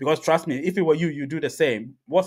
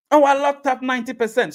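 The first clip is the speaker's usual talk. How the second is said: much higher, louder mocking voice; mock excuse; 10 semitones higher, 5 dB louder than usual. Mocking sellers who brag about locked coins. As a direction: parrot their excuse sarcastically. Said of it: "mocking sellers' excuse".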